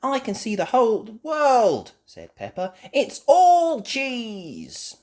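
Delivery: very high, loud, slow marvel; awed Peppa. Acted. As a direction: savour the view.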